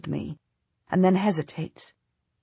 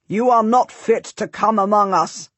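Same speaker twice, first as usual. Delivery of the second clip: scornful lord, louder; contemptuous; 4 semitones higher, 6 dB louder than usual. Acted. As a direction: sneer the insult down at them. Salisbury insults them.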